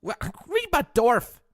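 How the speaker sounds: very high, slow, loud whine; whiny voice. Acted. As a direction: whimper it like a spoiled brat. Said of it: "the whiny little bitch ones".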